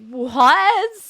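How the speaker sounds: stunned what. Retold or imitated. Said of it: "it just was like".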